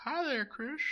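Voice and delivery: put-on very high wheezy old man; creepy neighbor voice. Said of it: "Yeah, do it. Try it".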